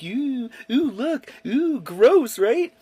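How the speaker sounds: high, mock-gleeful ooh-ing at a grossed-out kid; imitating mom teasing. Mother shoves skin at son's face, high mocking ooh-ing. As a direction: tease like a childish mom waving something gross.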